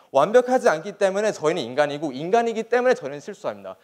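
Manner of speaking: ordinary talk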